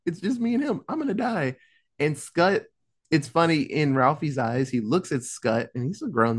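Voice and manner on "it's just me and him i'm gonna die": high-pitched retold panic; mock panic. Ralphie alone with his old bully, mock panicked. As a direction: play up the dread, pitched way up.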